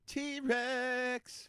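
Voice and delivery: high, stretched-out mock roar of a name; goofy high-pitched holler; silly drawn-out cry. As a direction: sing it out high and long. Echoing the word as a silly cry.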